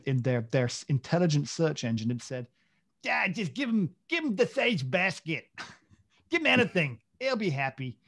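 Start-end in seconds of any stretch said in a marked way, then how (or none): 3.0-8.0 put-on high, loose New Jersey accent; hammed-up New Jersey accent, pitched up. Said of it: "that was my New Jersey accent".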